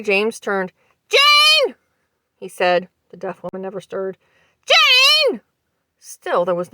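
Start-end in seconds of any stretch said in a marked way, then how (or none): 1.1-1.7 loud, high call across the room. A narrator's reading. Husband calls to deaf wife. 4.6-5.4 loud, high call across the room. A narrator's reading. Husband calls to deaf wife.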